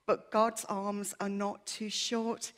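ordinary delivery

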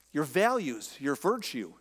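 usual speaking voice

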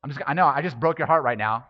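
ordinary talk

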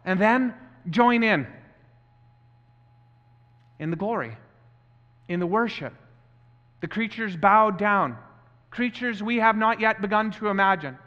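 fervent, measured sermon delivery; preaching. Real, not acted. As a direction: urge the congregation into worship, drawing out key phrases. Preaches to congregation.

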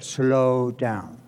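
slow, low, stretched words; drawn-out slow down. Acted. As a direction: ease on the brakes with long vowels. Responde qué hay que hacer. (lento, grave y alargado)

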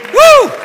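high, loud "woo" cheer; exultant whoop. Real, not acted. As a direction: let out a high whoop of praise. Rousing congregation to applause.

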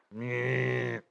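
drawn-out "meh" like a buzzer; mock buzzer noise. Teacher marking Josh's wrong choice, imitated buzzer. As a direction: sound a wrong-answer buzz by mouth.

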